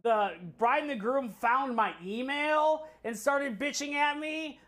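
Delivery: ordinary delivery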